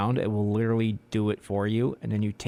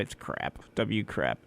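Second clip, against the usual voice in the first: whispered aside; whispering; 4 semitones lower, 7 dB quieter, slower than usual. Real, not acted. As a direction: breathe it out unvoiced. Whispered station joke.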